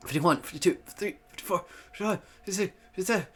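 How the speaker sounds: slurred counting through a held smile; real mumbled count, mouth held stiff; garbled, effortful counting. Real, not acted. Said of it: "Those aren't even words".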